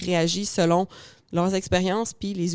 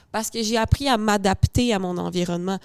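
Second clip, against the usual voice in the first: usual speaking voice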